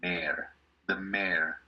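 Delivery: "mayor" as one slow syllable, MAIR; pronunciación en inglés. Shown as a demonstration. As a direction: model "mayor" slowly, like "mare". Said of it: "la de acá pronunciamos en inglés". ("mayor" dicho despacio como MER)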